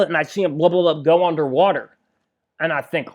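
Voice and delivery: ordinary talk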